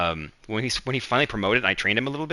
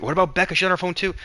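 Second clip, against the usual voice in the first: imitated defensive objection, much higher; pitched-up put-on complaint; retold protest; 10 semitones higher, 4 dB louder, faster than usual. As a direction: deflect blame in a high, louder mock voice.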